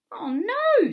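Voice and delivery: loud, high cry of dismay; high-pitched, crushed outburst; dismayed exclamation. A narrator's reading. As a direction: cry out in alarm at bad news. Dismayed cry at bad news.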